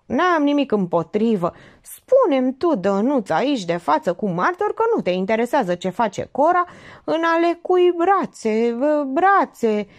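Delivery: high, emphatic, mounting voice; needling uncle. Acted. Uncle challenges Dănuț before a witness, needling. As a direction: press him, stretching out the final words.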